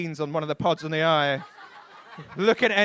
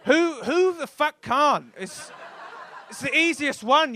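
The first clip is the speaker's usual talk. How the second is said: ordinary talk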